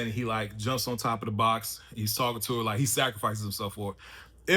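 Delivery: ordinary talk, nothing marked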